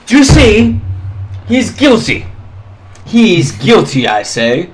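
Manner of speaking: high, loud alarmed cry; recoiling in alarm. Acted. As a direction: point and shriek in panic. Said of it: "kinda recoils back and goes".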